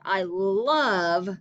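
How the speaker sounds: love drawn out and leaned on; love said big, like it's in all caps; stress on love. Shown as a demonstration. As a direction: hit the word love hard. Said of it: "really stress that word".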